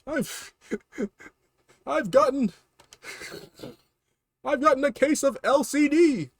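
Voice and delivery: high, pleading little-brother voice with laughs; whiny Luigi. Acted. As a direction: squeal for Mario's help.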